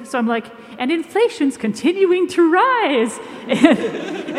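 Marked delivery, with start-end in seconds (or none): 0.8-3.2 put-on slow, high sing-song baby talk. Shown as a demonstration. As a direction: read the news like talking to an infant. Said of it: "like, a baby voice".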